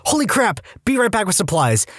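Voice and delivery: high, startled panic; alarmed exclamation. Retold or imitated. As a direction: blurt it out in alarm. Nurse sees reopened incision.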